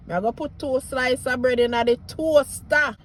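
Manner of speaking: easy Jamaican patois sentence. Shown as a demonstration. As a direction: speak it like a Jamaican local. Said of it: "Jamaican patois".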